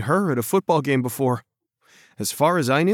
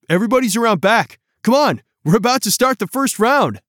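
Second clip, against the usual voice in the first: higher, louder, fast and eager; eager host; 9 semitones higher, 6 dB louder, faster than usual. A narrator's reading. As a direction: hurry them along. Host calls guests round back.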